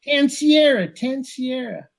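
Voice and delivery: very high Minnesotan movie-quote voice; Fargo movie accent. Retold or imitated. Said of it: "remember a quote from Fargo".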